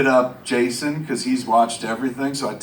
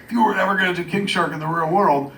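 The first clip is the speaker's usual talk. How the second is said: usual speaking voice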